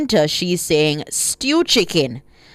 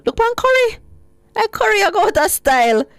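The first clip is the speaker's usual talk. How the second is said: gleeful high cry; 12 semitones higher than usual. Real, not acted. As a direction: crow over curry winning, delighted. Gleeful about curry winning.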